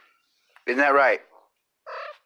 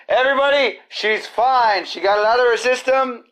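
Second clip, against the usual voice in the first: relieved announcement; 8 semitones higher, 3 dB louder, faster than usual. Real, not acted. Relieved news about cat.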